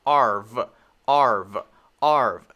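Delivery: "Arv" drilled loud and low, voiced V; R plus V. Shown as a demonstration. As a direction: separate "Arv", R gliding into buzzing V. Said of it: "an R sound and a V sound".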